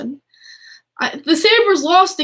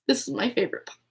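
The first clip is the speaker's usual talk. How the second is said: much softer, tender repeat to herself; softer echo; 2 semitones lower, 9 dB quieter, much faster than usual. Shown as a demonstration. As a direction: say it again quietly, almost to the puck.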